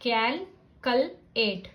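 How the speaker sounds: कैल, कल, एट slowly, short u in कल; short sound first. Shown as a demonstration. Teacher trying short sound first, कैल, कल, एट in slow pieces. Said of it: "try with short sound first".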